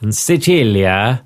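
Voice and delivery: Sicilia in Italian, see-CHEE-lya; Italian place name. Shown as a demonstration. Reader explaining the translation, Sicilia said in Italian. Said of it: "It's written Sicily and Naples".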